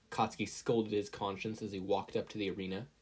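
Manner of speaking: plain reading voice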